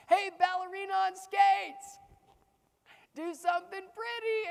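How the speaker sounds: sing-song it to mock him; taunting bully. Bullies jeering at the boy skater through the fence.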